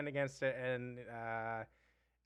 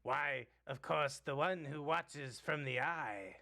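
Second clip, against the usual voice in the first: goblin voice. Acted.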